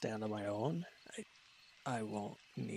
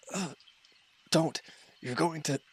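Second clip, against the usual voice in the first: higher, louder sudden cry of alarm; startled shout of warning; 7 semitones higher, 7 dB louder than usual. Acted. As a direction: cut in fast to stop the fall. Helper about to fall over.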